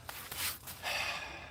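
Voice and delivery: soft, drawn-out exhale; long sigh. Real, not acted. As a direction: let out a long, quiet breath. Sighs after burping.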